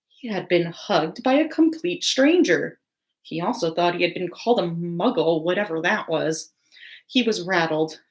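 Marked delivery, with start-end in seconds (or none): none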